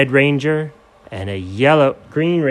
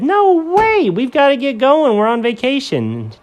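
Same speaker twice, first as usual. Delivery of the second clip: high Doug; 12 semitones higher than usual. Acted.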